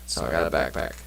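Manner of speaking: ordinary talk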